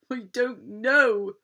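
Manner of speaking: slow, loud blustering pirate. Acted. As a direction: bluster helplessly. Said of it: "blustered Captain Stubble".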